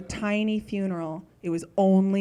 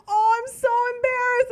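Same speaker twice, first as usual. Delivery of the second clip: mortified aunt; 4 dB louder than usual. Retold or imitated.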